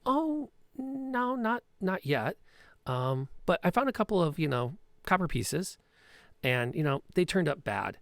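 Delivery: high, hesitant, innocent-sounding excuse; evasive Petra. Acted. Petra feeds the thug a coded excuse, high and hesitant. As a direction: stall with a coded cover story. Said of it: "And she goes".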